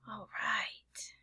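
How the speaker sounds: soft whispered all right. Acted. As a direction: hush it out. Hushed transition.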